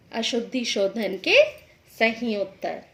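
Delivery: ordinary talk, nothing marked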